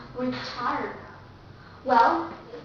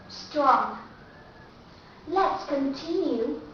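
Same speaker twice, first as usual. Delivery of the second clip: ordinary talk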